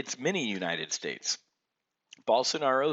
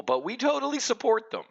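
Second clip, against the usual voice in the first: sarcastic mock voice, much higher and louder; mocking plaintiffs; 14 semitones higher, 6 dB louder than usual. Mocking the plaintiffs' lawsuit in a sarcastic high voice. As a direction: sneer it out in their fake-nice tone. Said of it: "mocking the plaintiffs' claim".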